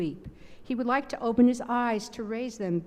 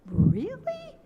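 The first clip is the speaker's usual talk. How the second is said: high, hopeful rising question; eager disbelief turning to hope; stronger, more assured. A narrator's reading. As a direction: ask it with new courage. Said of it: "now his voice is stronger, more assured".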